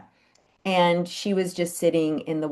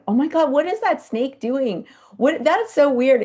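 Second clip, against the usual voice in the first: high, amazed gasp of bystanders; startled onlookers; 8 semitones higher, 5 dB louder than usual. Retold or imitated. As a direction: react like a baffled zoo visitor. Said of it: "people were coming in going".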